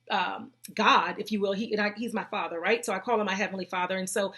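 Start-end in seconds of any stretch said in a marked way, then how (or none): none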